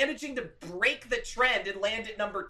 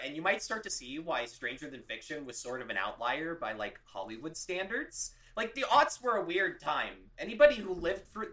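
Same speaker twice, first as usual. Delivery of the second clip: usual speaking voice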